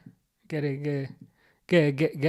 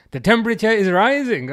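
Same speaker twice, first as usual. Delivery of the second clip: higher and louder triumphant announcement; victory cry after decoding; 8 semitones higher, 7 dB louder than usual. Real, not acted. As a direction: proudly announce the translation. Triumphant translation after struggle.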